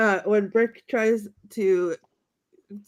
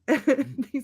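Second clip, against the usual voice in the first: normal talking voice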